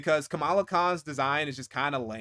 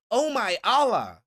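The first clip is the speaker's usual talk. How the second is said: mocking imitation, higher-pitched; cringy exclamation; 6 semitones higher, 4 dB louder than usual. Imitating a character's cringy exclamation, mockingly. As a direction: mimic a cringy outburst, pitched up. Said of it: "doing that cringy shit".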